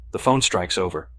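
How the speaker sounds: ordinary delivery, nothing marked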